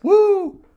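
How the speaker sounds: loud, high whoop; shrieked party whoop; cheer. Real, not acted. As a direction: let out a celebratory "woo". Cheering the first guest.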